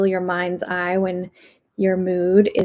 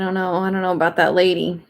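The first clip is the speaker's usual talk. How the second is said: usual speaking voice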